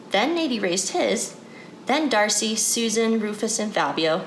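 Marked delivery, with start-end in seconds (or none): none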